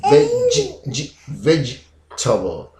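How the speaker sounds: "vegetable" taken apart as ve, ji, veg-table; broken-down syllables. Shown as a demonstration. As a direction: isolate the small middle syllable of "vegetable". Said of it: "it's very, very silent".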